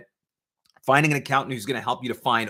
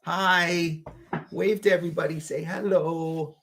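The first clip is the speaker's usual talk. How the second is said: higher, stretched coaxing hello; 5 semitones higher than usual. Real, not acted. Dad coaxing his baby to greet viewers, higher baby talk.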